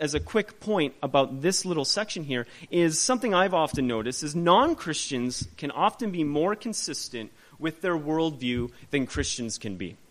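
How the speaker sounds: ordinary talk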